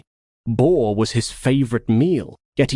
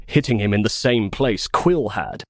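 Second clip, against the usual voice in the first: plain reading voice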